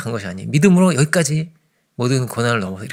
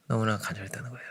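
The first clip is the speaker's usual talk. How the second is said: 너무나 간절했다는 거예요 breathed in a hushed whisper, much softer; whispered echo; 2 semitones lower, 13 dB quieter than usual. Shown as a demonstration. Preacher to congregation, echoing the blind man's longing in a whisper. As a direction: let the repeat sink to a whisper. Repeats line, then whispers it.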